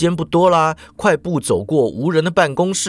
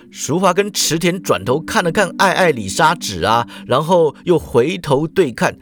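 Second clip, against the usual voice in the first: usual speaking voice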